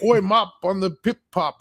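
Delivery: clumsy mock-British exclamation; bad British accent. Shown as a demonstration. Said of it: "if you can do a British accent, but I can't".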